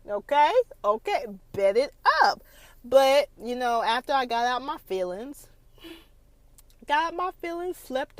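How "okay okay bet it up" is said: very high, loud sassy outburst; mock-outraged wife, much higher and louder; sassy exclamation. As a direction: blast it out, hammed-up sass. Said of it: "I was like".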